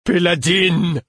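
high, loud, drawn-out wail falling away; distraught cry. A narrator's reading. Laudevin calls to the dead Péladine, despairing loud wail.

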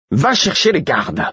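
high, exasperated command; near shout. A narrator's reading. Murfess at the end of patience, nearly shouting. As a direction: order just short of yelling. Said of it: "que Murfess ne hurle".

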